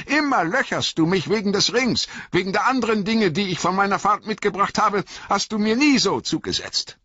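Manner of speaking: high-pitched, quick accusation; sharp, suspicious annoyance. A narrator's reading. Bilbo accuses the wizard of pestering him, sharp and suspicious. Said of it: "Sie klang scharf vor Ärger und Argwohn".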